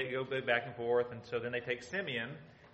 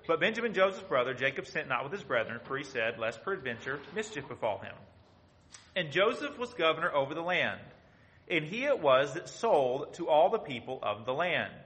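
loud, declaiming Bible reading; proclaiming King James verses to the congregation; scripture reading; 2 semitones higher, 7 dB louder than usual. Acted. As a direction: read the verses out like a preacher. Reads scripture aloud.

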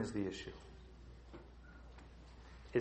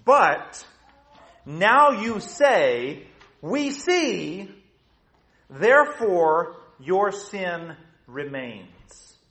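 slow, loud preaching with raised pitch; forceful preaching; 7 semitones higher, 8 dB louder, much slower than usual. Acted. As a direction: hammer out each phrase, long pauses between.

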